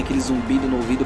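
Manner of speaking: normal talking voice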